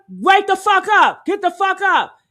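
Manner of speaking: high, loud re-enacted yell; imitated urgent shout. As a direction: shout it like rousing someone out cold. Re-enacts shouts heard on audio.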